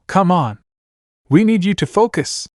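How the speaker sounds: urgent, high-pitched call without real volume; mild yell. A narrator's reading. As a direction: lightly yell to rally a teammate. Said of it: "she yelled as she pulled him".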